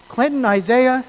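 a hint of a stern mom calling her kid; mom scolding with full name. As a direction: call out both names like a mom who means trouble. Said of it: "when mom says your name twice".

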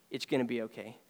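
soft, low and calm; quiet reassurance. Retold or imitated. Mother says it quietly to herself in grief, low and calm. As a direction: hush it like comforting yourself.

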